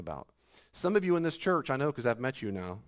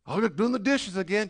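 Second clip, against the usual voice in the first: mock complainer; 7 semitones higher, 4 dB louder than usual. Voicing a complainer.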